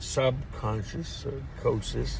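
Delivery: subconscious said slowly and softly, syllable by syllable; slow careful word. Real, not acted. Man finally getting the word out, slow and soft. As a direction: ease out subconscious slowly and quietly. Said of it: "There you go".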